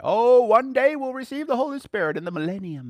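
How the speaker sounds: high, loud mock-dreamy voice; lofty someday sing-song, hammed up; mocking believers. Mimicking believers who postpone the Spirit.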